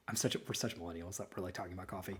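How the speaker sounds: a quick unvoiced whisper, half to himself; whispered aside. Real, not acted. Sheepish host whispering a joke about millennials, whispered. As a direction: mutter the self-mocking aside under his breath.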